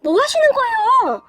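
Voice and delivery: high, alarmed objection; startled protest. A narrator's reading. Wife breaks up the brothers' fight, startled protest. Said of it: "제가 깜짝 놀라서".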